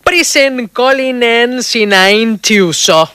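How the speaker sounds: slow, drawn-out made-up English gibberish; fake foreign language. Shown as a demonstration. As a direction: sound out the invented title slowly. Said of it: "voy a tratar de decírtelo".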